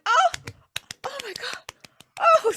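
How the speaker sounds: high gasping oh my God; high astonished crowd; amazed partygoers. Acted. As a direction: crowd gushing in wonder. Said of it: "they're all like".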